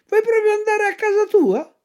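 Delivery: high, sly fox voice taunting the puppet. Acted. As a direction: tease him like a cunning fox. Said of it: "disse la volpe".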